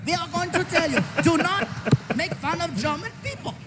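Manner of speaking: put-on lightly done German accent, stern warning. Joke German threatening the comedian, light German accent. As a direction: warn them like a threatening German. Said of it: "with the accent".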